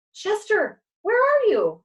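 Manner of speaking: high, loud calling out; alarmed cry. A narrator's reading. Dog who cannot see his friend, crying out. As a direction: call out for a lost friend. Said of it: "I cried".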